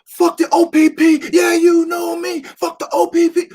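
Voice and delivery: high, loud rapper chant into the mic; high rowdy stage yell; hype mic shout. Retold or imitated. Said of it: "He's like".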